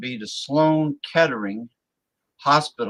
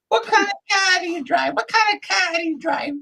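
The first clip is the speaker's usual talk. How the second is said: high falsetto woman's voice; squeaky high lady voice; female voice. Acted. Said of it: "me do the female voice".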